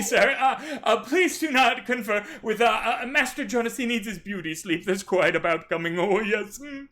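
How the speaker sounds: high, loud, fussy butler; flustered manservant guarding his master; fussy servant voice. Acted. Fussy servant blocks the tent.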